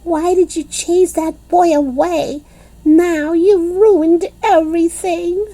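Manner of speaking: high, loud, upset scolding; angry girl. A narrator's reading. Lucille scolding the girls for ruining everything, angry. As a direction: blame them furiously. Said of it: "very angry".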